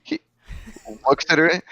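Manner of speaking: normal talking voice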